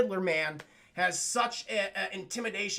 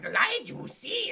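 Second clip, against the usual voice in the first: high, theatrical villain voice; shrill pompous sewer ruler; Sewer King voice; 10 semitones higher, slower than usual. Retold or imitated. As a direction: hiss it like a creepy cult leader. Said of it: "And he's like".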